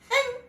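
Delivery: sharp high huff of contempt; mock indignant snort; scornful huff. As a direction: scoff and turn away in a huff. Imagined woman scoffs, storms off.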